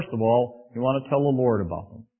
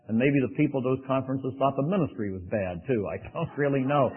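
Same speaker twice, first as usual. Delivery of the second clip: usual speaking voice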